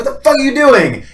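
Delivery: loud, high, incredulous demand; exasperated outburst. Retold or imitated. Imitating exasperation at people guessing blue bowls. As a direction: throw up your hands at the absurdity.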